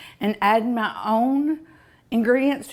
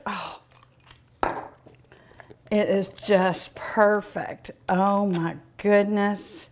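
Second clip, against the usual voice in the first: usual speaking voice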